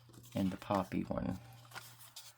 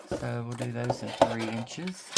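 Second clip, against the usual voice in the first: usual speaking voice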